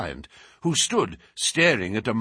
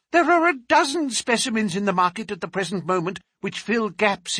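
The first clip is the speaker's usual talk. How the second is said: put-on higher, excited old collector; eager collector; 11 semitones higher than usual. A narrator's reading. Collector telling Holmes his hopes, eager. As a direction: gush over the dream collection.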